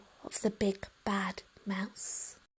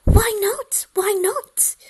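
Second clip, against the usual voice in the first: put-on much higher child voice, pleading; a small monster begging to know; eager little child; 11 semitones higher, 10 dB louder, slower than usual. Gruffalo's child asks eagerly.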